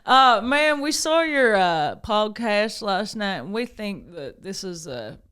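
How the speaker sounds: put-on a light, half-joking Texan accent; playing a Texan official in a mild Texan accent. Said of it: "Everything's better in a Texan accent".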